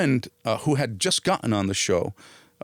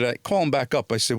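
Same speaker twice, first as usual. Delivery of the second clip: ordinary delivery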